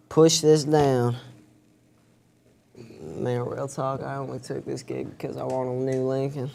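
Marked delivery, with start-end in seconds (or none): 3.0-6.5 confiding aside. Acted. McConaughey aside.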